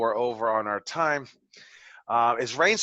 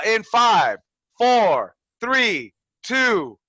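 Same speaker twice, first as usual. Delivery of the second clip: loud countdown; 8 semitones higher, 8 dB louder, much slower than usual. Real, not acted. Host loudly counts Rain into her sixty-second promo. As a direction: call out each number, loud and drawn out. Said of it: "so I will count you down".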